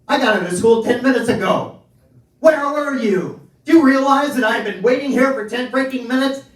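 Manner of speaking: high, loud indignant scolding; angry son rant. Retold or imitated. Son scolding his late father, high and loud. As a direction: rant like a fed-up kid left waiting.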